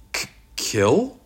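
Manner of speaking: stuttered, high rising question; nervous stammer. A narrator's reading. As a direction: stumble on the first sound in alarm. Ira alarmed at the word kill.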